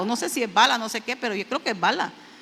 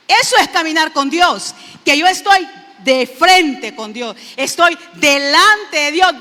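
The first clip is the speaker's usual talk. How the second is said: fast, loud, raised preaching voice; fervent preaching; 5 semitones higher, 10 dB louder, slower than usual. Acted. Preacher exhorting the brethren about Enoch, loud and fervent. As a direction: preach it out to the congregation.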